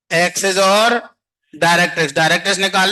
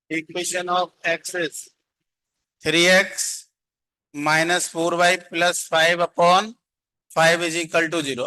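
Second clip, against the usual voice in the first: slow dictation; much slower than usual. Shown as a demonstration. Slowly dictating equation to students.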